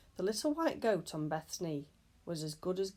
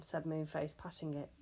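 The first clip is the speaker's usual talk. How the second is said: plain reading voice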